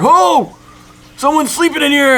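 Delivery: high, loud surprised yell from the dark; retold shout of a startled sleeper; imitating a man woken in the crate, yelling. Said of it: "he's yelling at us".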